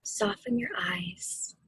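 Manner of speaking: slow, hushed meditation guidance; guided meditation whisper. Acted. Guiding the family through meditation, slow whisper. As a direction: breathe the words out gently, unhurried.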